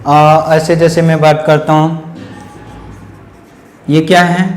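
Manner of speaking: ordinary delivery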